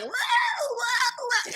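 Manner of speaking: a mock melting-witch wail. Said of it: "and she was like".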